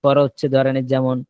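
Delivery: normal talking voice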